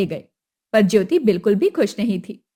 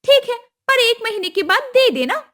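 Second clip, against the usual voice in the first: higher, pushy demand; demanding mother-in-law; 11 semitones higher, 5 dB louder than usual. Acted. Kusum demanding the dowry at the wedding, high and pushy. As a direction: ask for the money like it's owed.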